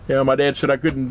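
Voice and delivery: ordinary talk, nothing marked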